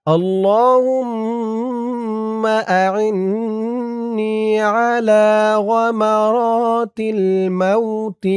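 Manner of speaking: slow, melodic Arabic chant; Quranic-style recitation. Acted. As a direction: recite the dua like tilawat, unhurried.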